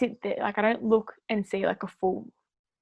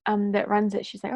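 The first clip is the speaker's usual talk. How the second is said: normal talking voice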